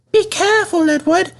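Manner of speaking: high, fussy coach voice; coaches. A narrator's reading. Coaches warning Edward not to bump them, high and fussy. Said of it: "said the coaches".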